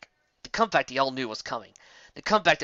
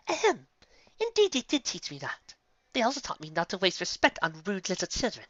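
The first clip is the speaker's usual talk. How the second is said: higher, cool and refined unicorn lady; haughty retort, higher pitched; prim lady; 6 semitones higher, 3 dB quieter than usual. A narrator's reading. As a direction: put him down with ladylike poise. Rarity's calm refined reply.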